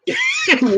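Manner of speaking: high squealing laugh; burst of laughter. Real, not acted. Reading a silly quiz aloud, she bursts out laughing. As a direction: break into a high, helpless laugh.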